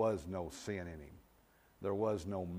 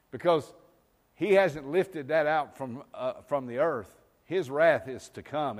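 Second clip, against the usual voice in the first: louder, higher preaching, hammering the word still; full-force preaching; 4 semitones higher, 8 dB louder than usual. Acted. Preacher pressing the congregation, full-force preaching. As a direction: drive it home from the pulpit.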